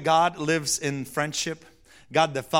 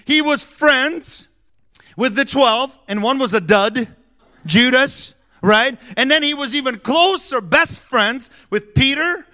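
loud, high, rolling sermon delivery; full force preaching; 8 semitones higher, 8 dB louder than usual. Acted. Pastor preaching to the congregation about friendship, loud. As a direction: preach it to the back row.

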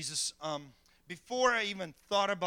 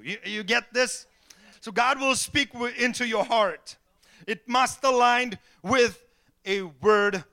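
loud, higher, ringing sermon voice; preaching at full force; 6 semitones higher, 12 dB louder than usual. Acted. Pastor preaching to his congregation, loud and fervent. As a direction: hammer the points home from the pulpit.

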